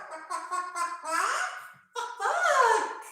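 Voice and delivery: high, loud buck buck with a laugh at the end; clucking, breaking into a laugh. Pretend. Owner clucking to her parrot Max, laughing as she goes.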